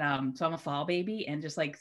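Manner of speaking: normal talking voice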